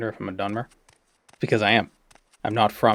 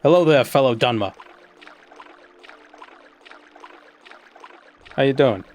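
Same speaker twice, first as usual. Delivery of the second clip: light mock Boston accent, a bit louder; 4 semitones higher, 5 dB louder than usual. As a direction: greet a fellow Dunmer in a playful Boston accent. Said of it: "speaking with a Bostonian accent".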